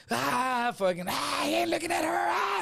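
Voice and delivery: high-pitched mock hollering; Def Comedy Jam style. Buddy in the car cackling at the confrontation, mock Def Comedy Jam hollering. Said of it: "saying everything like Def Comedy Jam style".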